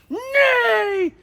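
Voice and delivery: high shrieking creature cry; horse dragon dinosaur impression. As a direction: screech it like a dinosaur. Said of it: "That's my horse dragon dinosaur impression".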